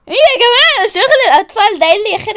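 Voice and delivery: loud, high-pitched telling-off; exasperated scolding. Acted. Scolding the childish game, exasperated. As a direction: rail at their nonsense.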